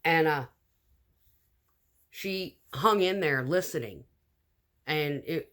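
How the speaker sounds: normal talking voice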